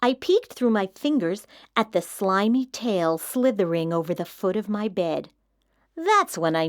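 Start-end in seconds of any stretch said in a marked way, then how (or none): none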